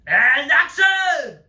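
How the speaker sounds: put-on loud, drawn-out French-accented call; director calling action. Director starts the actors' scene, loud drawn-out call. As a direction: call out slowly and loudly to start the take.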